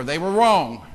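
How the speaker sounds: ordinary talk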